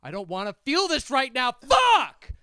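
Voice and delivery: high, loud meltdown building to a scream; imitating a man overwhelmed by his own rage; frustrated wail exploding on the last word; even madder. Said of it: "he got even madder".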